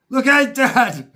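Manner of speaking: loud, high, slow shout; calling out. Retold or imitated. A boy on the roof calling down to his dad, loud high shout. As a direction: yell it down from the roof.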